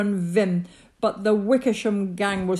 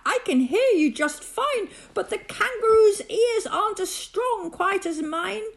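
high, urgent raised voice; insistent, high-pitched calling; calling back; 9 semitones higher, 4 dB louder than usual. A narrator's reading. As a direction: press the mayor with anxious questions. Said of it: "But Horton called back".

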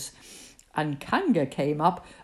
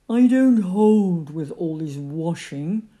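slow, louder grumble; grumbling; 5 dB louder, slower than usual. A narrator's reading. Eeyore objects to all the washing, slow grumbling. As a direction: drag it out in disapproval. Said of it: "grumbled Eeyore".

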